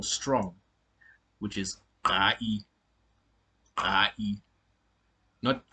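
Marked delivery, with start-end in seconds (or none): 2.0-2.4 GAI with a rough, constricted throat, smoothly; gai said rough and deep in the throat without a harsh trill; strident vowel. Shown as a demonstration. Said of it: "pronounced in a very rough and deep way". 3.8-4.4 GAI rough and throaty, louder than talk; gai with a deep, rough vowel, no harsh trill; strident vowel. Shown as a demonstration. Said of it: "pronounced in a very rough and deep way".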